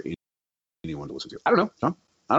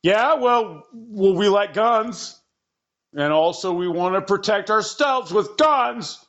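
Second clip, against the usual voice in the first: whiny mock voice; 11 semitones higher, slower than usual. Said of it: "Henry and Mason are like".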